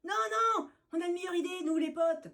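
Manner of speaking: high, fast eager chatter; alley cats crying out together. Acted. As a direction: rush it out with excitement. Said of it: "crient en chœur les minettes mitées".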